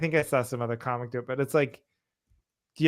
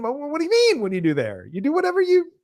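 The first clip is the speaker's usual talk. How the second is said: mock incredulous; 10 semitones higher, 7 dB louder, faster than usual. Incredulous retort.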